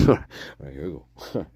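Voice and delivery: ordinary talk, nothing marked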